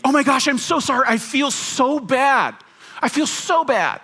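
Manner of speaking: gushing, slow, overdone apology; hammed-up over-apologizer. Shown as a demonstration. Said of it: "That one I will call the over apology".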